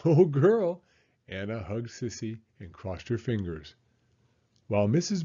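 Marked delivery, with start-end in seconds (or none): 0.1-0.8 high, loud happy whoop; excited cheer. Acted. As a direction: cheer with delight. Child cheers about the baby.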